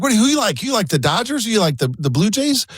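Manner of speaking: high-pitched upbeat greeting to a whole room; positive, smiling voice. Retold or imitated. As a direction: imitate a big personality rallying strangers with a smile. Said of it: "smiling voice".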